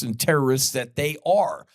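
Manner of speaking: ordinary talk, nothing marked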